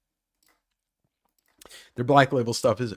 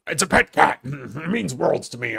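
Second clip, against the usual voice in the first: put-on alien bug voice, starting loud and high; 3 semitones higher, 5 dB louder than usual.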